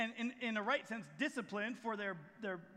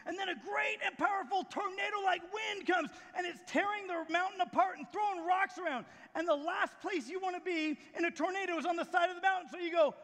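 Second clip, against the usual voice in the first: high, raised storytelling voice; preaching at full force; 6 semitones higher, 4 dB louder than usual. Acted. Preacher retelling the tornado on the mountain, full-force preaching. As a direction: build the storm in a raised, urgent voice.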